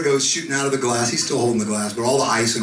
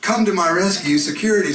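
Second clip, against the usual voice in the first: higher, pleading call; mock call for help; 8 semitones higher, 4 dB louder than usual. Emcee calling the bouncers over the PA, mock pleading. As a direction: cry out for rescue in a hammed-up plea. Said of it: "called over the P A".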